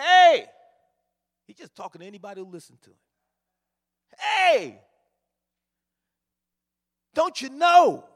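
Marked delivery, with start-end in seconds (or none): 0.0-0.5 sudden high, loud yell of hey; street preacher shouting. Retold or imitated. Street preacher yelling to passersby, loud shout. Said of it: "and he's shouting". 4.2-8.0 high, loud calling out; street preacher shouting. Retold or imitated. Preacher calls out to people on the street, loud shout. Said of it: "and he's shouting".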